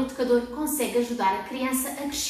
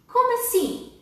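playful surprise; 9 semitones higher than usual. Acted. Mock question to kids.

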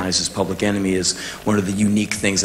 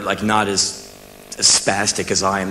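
ordinary talk, nothing marked

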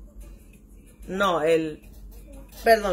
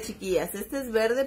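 normal talking voice

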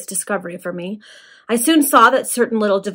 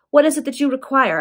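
higher, louder pleading question; supplicating; 6 semitones higher, 5 dB louder than usual. A narrator's reading. Adeline asking her captor's terms, supplicating. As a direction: plead to know the demand. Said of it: "in a faint and supplicating tone".

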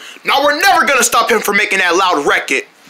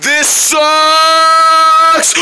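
high, long-held shout; play-acted holler; 5 semitones higher, 4 dB louder than usual. Player amid the chaos, high sustained holler. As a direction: belt it and hold the last word.

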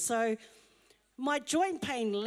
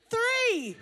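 building count; 9 semitones higher, 6 dB louder than usual. Retold or imitated. Counting up dessert pieces.

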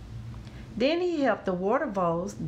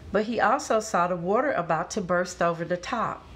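plain reading voice

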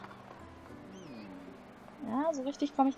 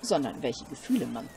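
put-on calm, lower old cook; lower wise cook voice; 5 semitones lower than usual. Streamer voicing the cook praising the ingredients, lower and calm. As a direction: speak like a wise old chef.

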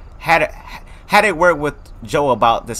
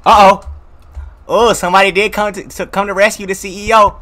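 normal talking voice

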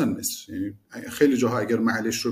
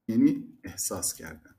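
usual speaking voice

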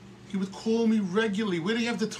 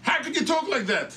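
high, loud retold protest; outraged outburst; 10 semitones higher, 5 dB louder, slower than usual. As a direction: cry out as if jumping from your seat. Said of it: "jump out of his seat and say".

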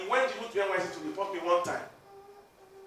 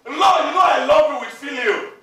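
loud answer; 4 semitones higher, 8 dB louder than usual. Acted. Peter protesting his love to Jesus, shouting. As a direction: shout Peter's reply, higher and louder.

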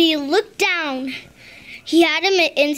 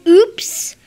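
surprised oops; 3 semitones higher, 7 dB louder than usual. Acted. Froggy notices his mistake.

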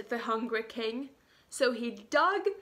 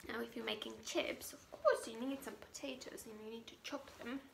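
whispered aside; 11 dB quieter, much faster than usual. Real, not acted. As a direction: lean in and whisper quickly.

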